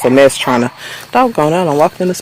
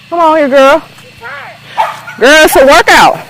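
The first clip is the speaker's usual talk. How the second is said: calling the dog; 14 semitones higher than usual. Real, not acted. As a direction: coax the dog along, pitched way up. Calling her dog while walking.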